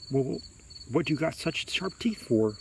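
slow, hesitant question; falter, then ask timidly; a touch of fear; mildly frightened boy. A narrator's reading. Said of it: "so frightened he can't move".

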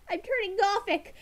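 ordinary delivery

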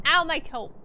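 loud mock ouch; pained yelp. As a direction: yelp at a stubbed toe. Stubs toe in game.

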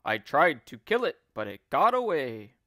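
loud, emphatic husband voice; mock alarm. Husband scares wife about the skillywigger, loud mock alarm. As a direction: tell it loudly to scare her.

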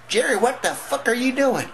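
high, loud screech of outrage; mock mother catching her son in the act; shocked mama yelling. Mama walks in, catches him.

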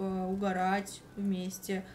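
ordinary talk, nothing marked

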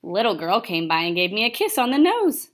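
usual speaking voice

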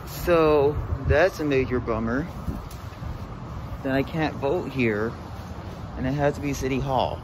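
loud, exasperated complaining; frustrated. Real, not acted. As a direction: vent your frustration out loud. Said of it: "I'm in an upset mood".

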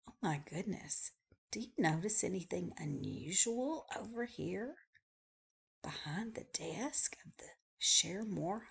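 soft conspiratorial whisper. Real, not acted. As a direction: lean in and whisper the secret. Pointing out a hidden oddity.

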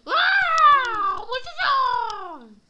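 very high, loud and slow; excited shriek. Real, not acted. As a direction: shriek it out, thrilled. Shrieking excitedly during game.

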